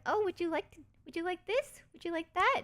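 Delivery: high, eager offering voice; sweet hospitable host. Retold or imitated. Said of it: "They're like".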